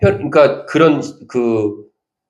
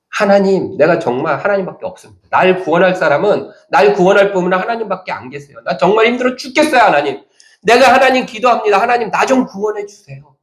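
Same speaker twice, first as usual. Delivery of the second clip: higher, pleading prayer cry; imitating a desperate believer crying out; desperate plea; 6 semitones higher than usual. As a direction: beg God for rescue. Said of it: "절박함과 간절함의 외침".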